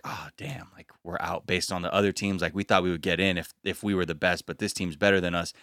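soft, dejected mutter; deflated team. Retold or imitated. Dancers realising the rival team is better, deflated and soft.

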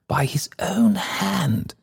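high, slow, horrified whisper; aghast whisper. A narrator's reading. Alderman aghast at the banker's suicide, whispering. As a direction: draw it out in a stunned whisper.

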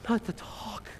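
unvoiced whisper; hushed whisper; whispered aside. Real, not acted. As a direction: whisper it like a secret dread.

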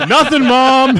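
high, loud, giggling through the words; laughing guilty kid. Pretend. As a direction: answer mom while cracking up. Voicing guilty kid Tesla.

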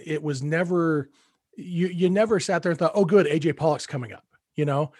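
usual speaking voice

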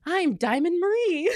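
high, drawn-out self-announcement; slow, high-pitched mock drunk introduction; drunk DJ persona. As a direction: announce a drunken DJ name with silly grandeur. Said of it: "blacked out brain was like".

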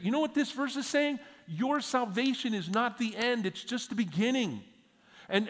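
loud, high preaching at full force; fiery sermon delivery. Acted. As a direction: preach it out, raising the voice to the room.

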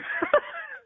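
high, helpless little laugh; quick high-pitched chuckle; short laugh. Real, not acted. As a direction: laugh briefly at your own predicament. Laughs at own headset predicament.

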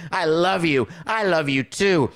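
mock the big movie confession; mock rom-com gush. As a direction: overblown rom-com declaration. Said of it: "come to Jesus and say".

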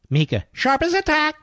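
high, sneering imitation of a TV host; mocking high voice. Mocking a TV host, high and sneering. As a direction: squeak it out to ridicule her. Mimicking the guest's praise.

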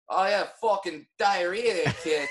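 a rough mock Boston accent, half laughing; lightly put-on bad Boston accent. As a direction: ham up a Boston local. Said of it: "That's the worst Boston accent".

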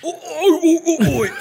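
high loud game cries; fighter grunts. Retold or imitated. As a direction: cry out like a hit fighter.

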